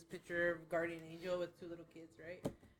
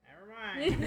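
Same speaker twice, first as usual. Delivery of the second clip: amused; 8 semitones higher, 9 dB louder, slower than usual. Retold or imitated. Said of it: "They're like".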